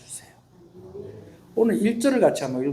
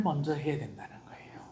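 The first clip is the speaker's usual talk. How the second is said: whisper; 12 dB quieter than usual. Real, not acted. Whispered aside in sermon.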